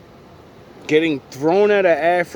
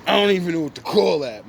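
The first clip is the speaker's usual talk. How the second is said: ordinary talk, nothing marked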